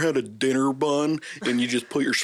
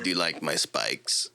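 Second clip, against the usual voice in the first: mock Bowser; 6 semitones lower, 4 dB quieter than usual. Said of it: "you're just like".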